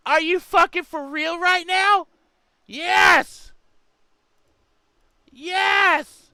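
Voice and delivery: loud, thrilled disbelief; excited shouting. Real, not acted. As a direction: yell with glee. Excited by breaking news.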